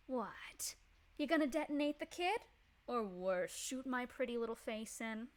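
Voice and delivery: honeyed threat over a ticking firebomb; sweetly mocking. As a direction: tease him sweetly like he's harmless. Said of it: "tilt her head sweetly".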